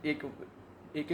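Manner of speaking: ordinary talk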